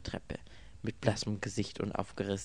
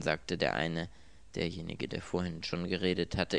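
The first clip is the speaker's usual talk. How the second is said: ordinary delivery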